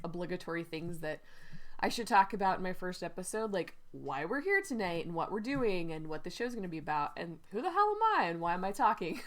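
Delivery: ordinary talk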